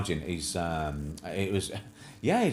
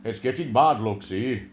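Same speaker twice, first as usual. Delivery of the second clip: put-on slow, louder Welsh lilt, boyo style; mocking the Welsh minister's lockdown speech in a slow, louder Welsh accent; 3 dB louder, slower than usual. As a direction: imitate the gloomy Welsh leader in a Welsh accent. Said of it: "And says".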